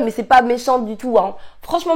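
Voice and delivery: ordinary talk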